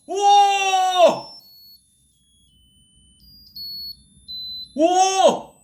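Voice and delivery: startled high shouts of wonder; amazed wow. Real, not acted. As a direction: shriek with sudden amazement. Candle device suddenly lights up. (깜짝 놀란 감탄의 외침)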